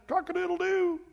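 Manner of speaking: high, light mock rooster crow; rooster crow imitation. Preacher caps Peter's third denial with a rooster crow.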